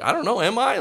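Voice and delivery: put-on high, unsure teenage reply; squeaky-pitched imitation of self-doubt; mock uncertain teen. Imitates unsure fifteen-year-old self.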